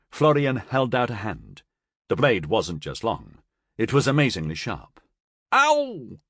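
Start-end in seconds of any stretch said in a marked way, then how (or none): 5.5-6.2 high, sudden "ow"; yelp of pain. A narrator's reading. Florian cut in the blood check, pained yelp. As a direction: yelp as the blade cuts.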